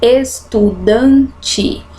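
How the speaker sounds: very slow, syllables drawn apart; slow dictation for counting syllables. Shown as a demonstration. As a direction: count out each syllable of "Estudante". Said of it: "quatro sílabas".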